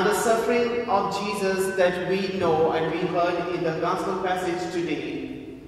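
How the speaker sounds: ordinary talk, nothing marked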